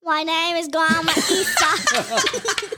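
slow, laughing put-on granny accent; a giggly play granny drawing out her words; Grandma Easter voice. Girl on a podcast plays a silly granny, laughing slowly. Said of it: "you have an accent?".

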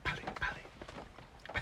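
slow, hushed copy of a whisper; whispered echo. Retold or imitated. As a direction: whisper it back softly and slowly. Echoes the child's whispered imitation.

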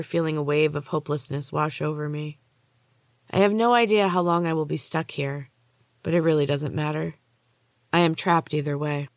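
usual speaking voice

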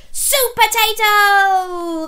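high, loud, drawn-out hero fanfare; triumphant high call; heroic announcement. A narrator's reading. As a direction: proclaim the superhero's name.

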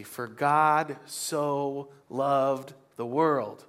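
very slow, each word drawn out; slow scripture reading. Shown as a demonstration. Pastor reciting John to the church, very slowly. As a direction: lay the verse down word by word. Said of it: "quoting scripture, then repeats".

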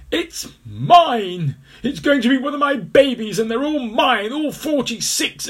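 high, loud, furious yelling; furious shout. Acted. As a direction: yell at the thieves. Angry mother crocodile.

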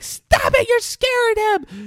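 very high, loud protest; retold shouted plea. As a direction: imitate a frantic mother yelling back. Mother's shouted plea in retold fight.

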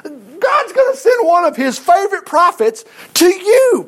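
high, drawn-out preacher's shout; full-force preaching. Real, not acted. Preacher promising a prophet to the congregation, full-force preaching. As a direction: proclaim it high, stretching the words.

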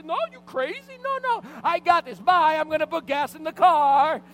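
screechingly high, loud yelling; freaking out. Retold or imitated. Husband storming off to get gas, freaking out. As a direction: scream it, stretching the final words. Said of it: "I was freaking out".

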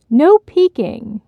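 put-on high, drawn-out little girl's warning; slow, high, loud no-peeking call; high-pitched child. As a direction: squeal it like an excited young daughter. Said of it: "her daughter's high-pitched voice".

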